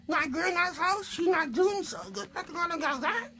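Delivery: put-on high squeaky puppet girl, a bit glum; small puppet friend sharing something sad. Puppet Cindy's sad answer.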